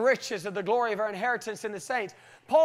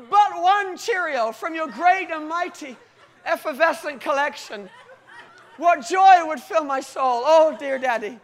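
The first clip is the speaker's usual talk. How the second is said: imitating a son in lofty, raised-pitch prayer; hammed-up pleading child; 4 semitones higher, 6 dB louder than usual. Small son begging his daddy for one Cheerio, hammed-up pleading. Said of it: "And he says".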